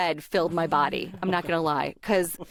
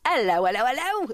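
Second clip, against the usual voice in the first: bad British accent, swooping up high at the end; Mary Poppins; 4 semitones higher than usual. Shown as a demonstration. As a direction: ham up a British singsong greeting. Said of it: "I sound like Mary Poppins".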